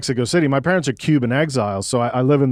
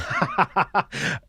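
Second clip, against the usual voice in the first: soft laugh; 8 semitones higher, 4 dB quieter than usual. Real, not acted. Asked about metal band.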